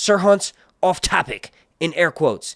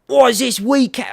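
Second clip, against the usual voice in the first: mock Scottish accent; 7 semitones higher, 4 dB louder than usual. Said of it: "let me engage my Scottish accent".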